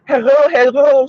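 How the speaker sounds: a hammed-up host greeting the chat; mock stream greeting. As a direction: mimic her bright stream opener. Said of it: "she start off her, her streams".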